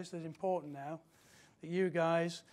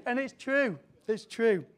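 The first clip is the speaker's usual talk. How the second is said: laughing, higher-pitched agreement; amused; 5 semitones higher, 6 dB louder, slower than usual. Real, not acted. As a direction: insist through a real laugh. Laughs after joke about children.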